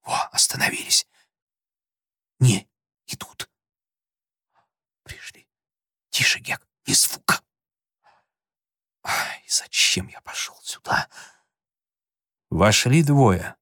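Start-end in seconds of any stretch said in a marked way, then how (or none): 0.0-7.4 tense urgent whisper; whisper, holding your breath. 9.0-11.1 scared, whispered moan; frightened whisper.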